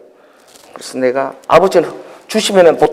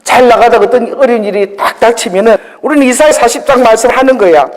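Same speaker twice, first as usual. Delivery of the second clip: ordinary talk, nothing marked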